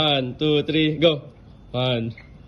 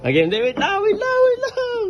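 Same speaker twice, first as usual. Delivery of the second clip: laughing while talking. Real, not acted. Laughing, can't stop himself.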